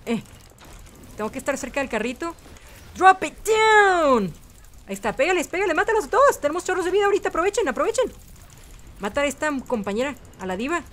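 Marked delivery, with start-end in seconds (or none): 3.0-4.3 high, loud, stretched-out "down"; hype catchphrase yell. Pretend. Player firing Lucio's ultimate, hype yell. 5.1-8.1 high, urgent rallying cries; excited urging. Real, not acted. Player urging teammates to attack, excited urging. Urging team on during boost.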